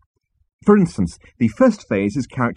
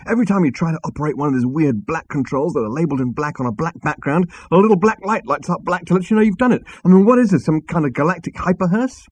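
high-pitched rant building louder; exasperated rant; 8 semitones higher, 4 dB louder than usual. Acted. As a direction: rant with mounting disbelief.

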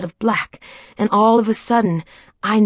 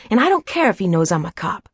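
ordinary delivery, nothing marked

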